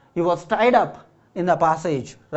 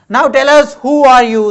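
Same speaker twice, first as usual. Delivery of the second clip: high, loud, demanding question; girl demanding answers from the stranger; eager question; 6 semitones higher, 9 dB louder than usual. A narrator's reading. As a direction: press the stranger to explain himself. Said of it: "Seema asked the man".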